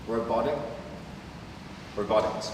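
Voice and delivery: ordinary delivery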